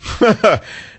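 short high laugh at himself; amused chuckle at his own accent; burst of laughter. Real, not acted. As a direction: laugh off the slip.

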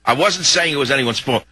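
ordinary talk

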